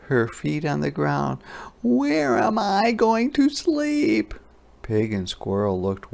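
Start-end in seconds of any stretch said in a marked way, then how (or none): 1.8-4.3 high, slow fretful voice; high slow worried hedgehog. A narrator's reading. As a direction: wail the question as a tiny hedgehog.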